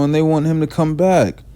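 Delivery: usual speaking voice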